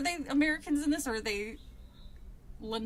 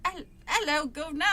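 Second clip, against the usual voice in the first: higher, louder hammed-up Cockney greeting; badly faked London accent; warm-up phrase in stagey Cockney; mock Cockney; 4 semitones higher, 3 dB louder than usual. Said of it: "what a fucking British accent sounds like".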